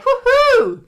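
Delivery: high, loud victory whoop; celebratory woohoo; joyful whoop. Pretend. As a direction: let out a cheer. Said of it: "And be like".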